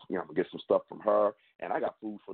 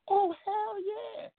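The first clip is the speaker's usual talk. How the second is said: much higher, slow, drawn-out hell yeah; over-the-top put-on yes; mock hype answer; much slower than usual. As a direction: stretch it out like a cartoon cheer. Answers own rhetorical question.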